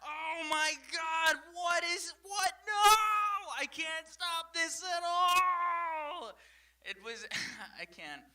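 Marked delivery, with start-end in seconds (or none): 0.0-6.3 shrieking in a high, slow panic; panicked high screaming while fighting; panicked high yelling. Retold or imitated. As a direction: wail it out, high and stretched, like you can't stop yourself. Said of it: "I was like".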